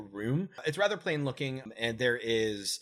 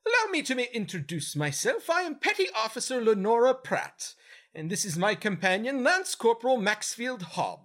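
much higher, louder officer voice; a formal lady soldier, pitched way up; female Gith voice; 9 semitones higher, 4 dB louder than usual. Acted. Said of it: "the first Gith says".

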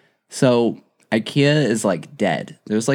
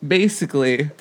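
high, squeaky laughter swallowing the words; real laughing fit. Real, not acted. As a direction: attempt the story and collapse into laughter. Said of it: "you're making me cry".

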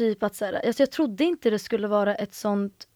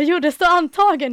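the sister insists, high and loud; excited insisting; 7 semitones higher, 7 dB louder than usual. Retold or imitated. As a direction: push the good news through his doubt. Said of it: "Hon bara". (ivrigt insisterande, högt och ljust)

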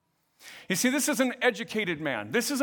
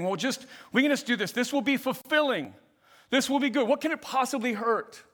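eager rationalizing; 7 semitones higher than usual. Acted. Voicing tempted person rationalizing.

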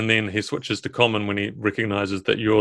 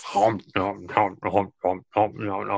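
softer mock chomping, nom nom; munching noises; 5 dB quieter than usual. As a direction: chew noisily like an ogre eating. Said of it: "he just bites the head of the eel off".

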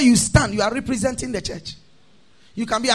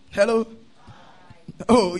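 ordinary delivery

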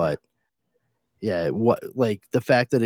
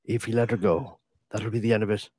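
movie threat impression. Quoting the famous phone threat, mock menacing. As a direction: deliver it like a deadpan action hero. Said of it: "Brad Pitt?".

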